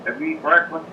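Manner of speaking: normal talking voice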